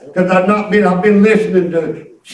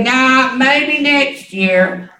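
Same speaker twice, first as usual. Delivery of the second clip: lightly voiced little girl, slightly raised pitch; imitated six-year-old; 3 semitones higher, slower than usual. Granddaughter declining river lesson, lightly mimicked child voice. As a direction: mimic a child putting it off, drawn out. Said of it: "She said".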